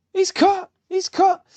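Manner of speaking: high excited film-trainer cry; excited trainer yell. Retold or imitated. Watching Rocky cut Drago, a high excited corner yell. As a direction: yell it like the corner man seeing blood.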